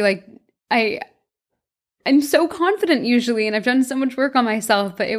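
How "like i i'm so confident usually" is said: ordinary delivery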